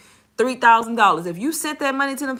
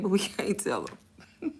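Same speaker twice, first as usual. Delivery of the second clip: hushed aside; 7 dB quieter, slower than usual. Real, not acted. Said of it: "conspiratorial aside to viewers".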